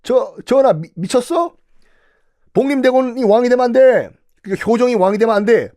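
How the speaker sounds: high, urgent protest to the king; objecting minister. Retold or imitated. Minister Kim Yuk protesting to King Injo, alarmed. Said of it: "김육이 반대를 해요".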